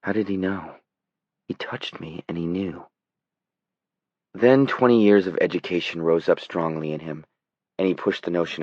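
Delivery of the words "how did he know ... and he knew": ordinary delivery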